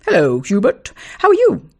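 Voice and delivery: high, bright-toned false friendliness; overfriendly greeting. A narrator's reading. Boy greeting his rival with sly friendliness. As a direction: greet him like an old pal. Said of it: "friendliness with which William accosted him".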